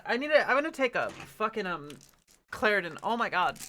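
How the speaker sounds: usual speaking voice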